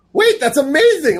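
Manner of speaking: very high, loud mock-excited burst; thrilled. Friend hears about the Green Lantern job, imitated as thrilled. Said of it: "he was so thrilled".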